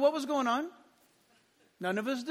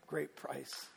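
ordinary talk, nothing marked

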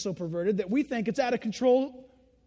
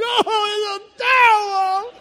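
put-on high, loud, crying child shriek; mock terrified wail; 11 semitones higher, 12 dB louder than usual. Terrified child crying that it's a devil, loud and high. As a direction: wail it like a panicked kid.

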